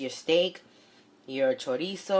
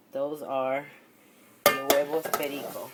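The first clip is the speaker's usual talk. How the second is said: normal talking voice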